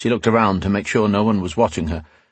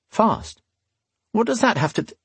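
puzzled; 14 semitones higher than usual. A narrator's reading. Alesh confused.

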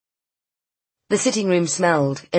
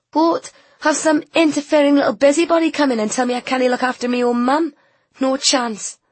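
loud, fierce and fast; 10 semitones higher, 5 dB louder than usual. A narrator's reading. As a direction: fight back fiercely.

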